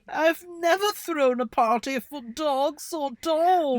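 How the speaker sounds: put-on high, slow posh transatlantic accent; put-on old-timey transatlantic accent. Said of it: "I love how everyone's British".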